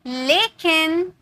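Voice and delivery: slow teacherly लेकिन with a rising end; drawn out. Shown as a demonstration. Teacher building suspense for the next rule, slow. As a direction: stretch लेकिन out slowly. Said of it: "teacher drawing out the word".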